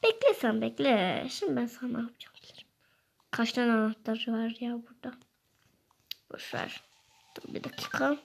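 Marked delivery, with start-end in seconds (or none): 1.3-8.2 fast unvoiced whisper of a plotter; scheming whisper. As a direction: plot revenge under the breath. Plotting torture in secret.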